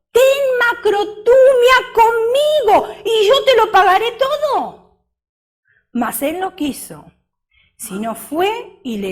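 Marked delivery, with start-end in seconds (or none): 0.1-5.0 high, loud half-sung plea; plead, almost singing it; begging servant. Acted. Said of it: "le rogaba diciendo". (ruego agudo y alto, casi cantado)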